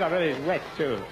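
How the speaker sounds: ordinary talk, nothing marked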